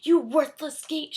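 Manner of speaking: very loud, slow witch scream; furious scream. A narrator's reading. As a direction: scream it at the gate. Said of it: "she screamed".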